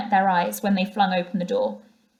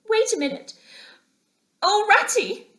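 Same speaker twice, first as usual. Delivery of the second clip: much higher cry of despair; 13 semitones higher than usual. A narrator's reading. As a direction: wail to your friend. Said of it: "he cried in despair".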